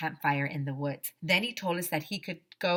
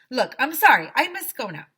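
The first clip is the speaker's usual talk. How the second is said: high, loud hurried excuse; hurried bear; 6 semitones higher, 9 dB louder than usual. Acted. Woodsy tries to escape the kids, high loud excuse. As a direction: brush them off in a raised voice.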